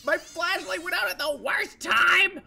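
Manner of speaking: high, loud frantic shout. Real, not acted. Player shouts as flashlight fails during attack. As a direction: yell in panic as the light dies.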